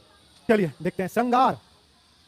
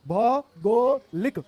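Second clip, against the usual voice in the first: भौगोलिक in stretched separate syllables; slow dictation; 3 semitones higher, 4 dB louder, much slower than usual. Shown as a demonstration. Teacher dictates the corrected word, slowly. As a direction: draw out भौगोलिक part by part.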